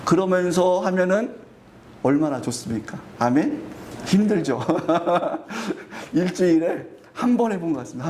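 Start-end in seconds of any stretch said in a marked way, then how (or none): 4.6-6.1 short embarrassed laugh; a sheepish little laugh; light chuckle. Real, not acted. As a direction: chuckle at yourself. Laughs at own confession.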